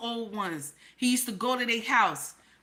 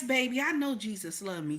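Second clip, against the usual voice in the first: usual speaking voice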